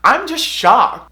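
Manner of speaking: high, loud, slow mock shock; shocked mom. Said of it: "And she goes".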